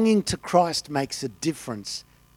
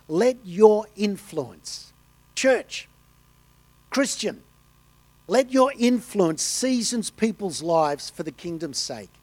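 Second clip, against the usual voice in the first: voicing Christ; 4 semitones higher, slower than usual. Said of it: "Christ is saying".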